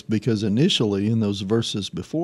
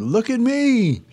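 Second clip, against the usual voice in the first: showing off; 12 semitones higher, slower than usual. Retold or imitated.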